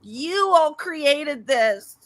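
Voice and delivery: high, very slow whining voice; whiny impression. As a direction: drawl it out in her whiny voice. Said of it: "You sound like her".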